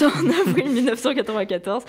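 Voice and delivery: giggling through "avril mille neuf cent quatre-vingt-quatorze"; laughing restart. Shown as a demonstration. Presenter laughs at her own slip and restarts the date. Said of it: "je recommence".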